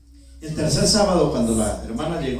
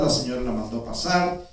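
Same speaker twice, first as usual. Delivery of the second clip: usual speaking voice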